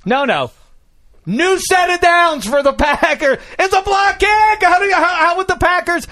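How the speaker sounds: loud, high-pitched incredulous rant; exasperated, near-shouting disbelief; flustered outrage. Real, not acted. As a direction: rant at full pitch, hammering out every phrase. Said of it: "I'm sorry, I'm flustered".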